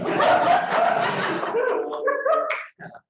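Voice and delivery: high bursting laugh at own joke; burst of laughter. Real, not acted. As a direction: break into loud laughter.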